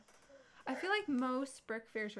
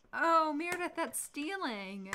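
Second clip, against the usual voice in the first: higher, louder opening, then drawn out; scolding mother; 5 semitones higher, 5 dB louder, slower than usual. Retold or imitated. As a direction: imitate a reproachful mom, slowing down. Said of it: "she was like".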